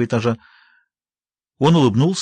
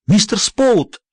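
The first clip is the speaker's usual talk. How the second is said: calling out; 7 semitones higher, much slower than usual. A narrator's reading. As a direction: call for him in panic. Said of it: "позвала она".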